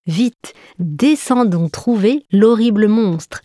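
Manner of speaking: usual speaking voice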